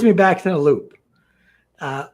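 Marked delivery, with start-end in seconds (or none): none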